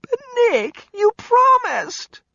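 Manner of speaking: high, slow crying plea; a little girl about to cry; tearful child. Acted. Kelly begging Nick in tears. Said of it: "Her bottom lip was starting to go".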